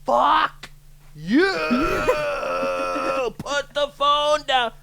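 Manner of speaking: high, loud, slow yell with a laugh; furious yelling. Retold or imitated. Angry driver yelling at a woman on her phone, furious. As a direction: scream it at her through the window.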